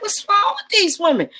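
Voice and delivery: incredulous. Retold or imitated. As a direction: hit it like you can't believe it. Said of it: "I'm like".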